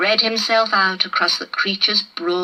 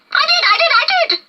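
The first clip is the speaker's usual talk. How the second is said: put-on very high, louder childish squeal; petulant insistence; 4 dB louder, much faster than usual. Sulky lizard insisting he scared the boy, shrill. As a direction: stamp your foot and insist.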